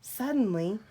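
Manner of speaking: usual speaking voice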